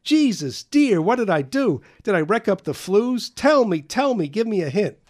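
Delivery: high, loud, frantic fretting; a father fearing he wrecked the flues; worried father. Retold or imitated. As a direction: beg for an answer, panicking. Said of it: "my father kept saying".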